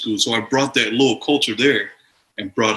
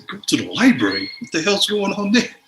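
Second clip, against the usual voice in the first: sudden high-pitched disbelief; quick, startled, higher disbelief at the page; retold surprise; 9 semitones higher, faster than usual. Student retelling being called to the library, startled and incredulous. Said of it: "I said".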